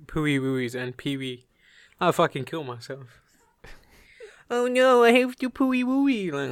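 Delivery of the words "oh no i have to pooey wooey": put-on very high, loud babyish whine; mock baby talk. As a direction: play a partner whining in baby talk. Acts a partner using baby words.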